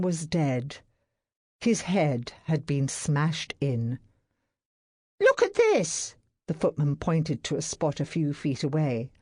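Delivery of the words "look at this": much higher footman's call. A narrator's reading. Footman showing master the hammer, high urgent call. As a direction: point it out urgently.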